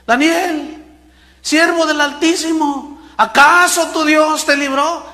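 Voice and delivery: high, loud, slow lament; lamenting king. Acted. King calling into the lions' den, high slow lament. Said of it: "lamentándose".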